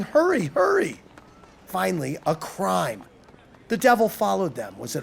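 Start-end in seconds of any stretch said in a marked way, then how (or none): 0.0-1.0 much higher, urgent cry without full volume; high-pitched, contained shout; mock shouting. A narrator's reading. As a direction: hurry them along in a high voice. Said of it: "a couple ran by shouting".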